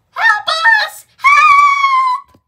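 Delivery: high, loud, frantic cries; desperate scream for help. Acted. Trapped character screaming for help, high and loud. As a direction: shriek for rescue like you're trapped.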